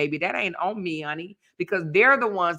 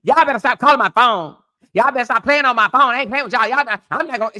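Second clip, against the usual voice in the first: mock scolding; 6 semitones higher, 5 dB louder, faster than usual. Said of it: "I'm like".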